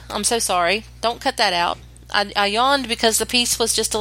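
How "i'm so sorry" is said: ordinary talk, nothing marked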